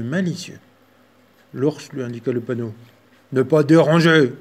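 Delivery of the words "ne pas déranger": loud growling bear; growled. Acted. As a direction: growl it at the little mouse. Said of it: "grogna-t-il".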